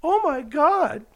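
very high, slow, frightened cry; scared. Retold or imitated. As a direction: gasp it out in terror. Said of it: "And I'm going".

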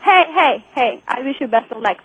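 ordinary delivery

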